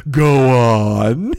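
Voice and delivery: Shakespeare greedily urging Rick to keep talking; eager prompting. Retold or imitated. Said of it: "And Shakespeare's like".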